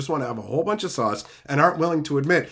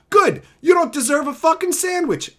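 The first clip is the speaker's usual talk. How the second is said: high, loud snapping retort; sudden shouted retort; angry shout; 10 semitones higher, 5 dB louder than usual. Real, not acted. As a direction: bark it at them. Angry outburst at chat.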